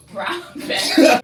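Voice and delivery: a real laugh bursts out in the middle, loud and high; breaking up laughing. Real, not acted. Teacher cracks up while listing the animals, laughing out loud. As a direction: lose it and crack up on the words.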